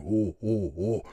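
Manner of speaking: ultra low rumble; ventricular subharmonic. Shown as a demonstration. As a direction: growl subharmonics under each oh. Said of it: "I can create subharmonic".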